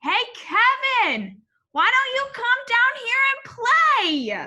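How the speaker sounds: high, loud call from below; a friendly wombat shouting up a tree. A narrator's reading. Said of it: "shouted one day".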